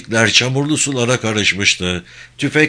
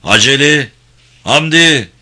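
loud, high shout of names; calling out; 4 semitones higher, 7 dB louder than usual. A narrator's reading. Soldier searching for friends after fighting, calling out loudly. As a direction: call out for missing comrades.

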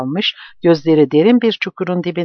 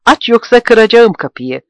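high, loud threat, voice not his own; threatening shout; 7 semitones higher, 6 dB louder than usual. Acted. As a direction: threaten to break the door, beside himself. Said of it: "Sesi artık kendi sesi değildi". (kendinden geçmiş, tehditkâr bağırış)

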